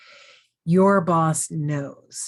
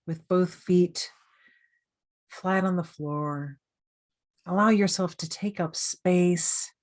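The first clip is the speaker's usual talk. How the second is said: guided meditation. Real, not acted. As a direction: gently guide the listener through breathing and self-thanks, unhurried.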